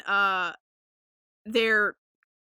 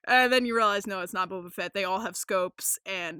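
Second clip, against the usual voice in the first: ordinary talk, nothing marked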